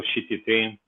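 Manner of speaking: usual speaking voice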